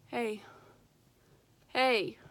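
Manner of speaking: ordinary talk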